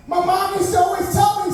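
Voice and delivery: normal talking voice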